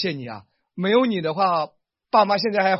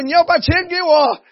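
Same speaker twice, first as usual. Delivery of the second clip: much higher, louder demand; wife playfully asking husband for money; 13 semitones higher, 12 dB louder than usual. Pretend. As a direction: chirp for the money cheekily.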